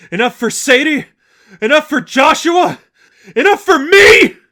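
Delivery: high, loud screaming questions, one per punch; furious screaming. Acted. Angel screaming at the seraphim with every punch, furious.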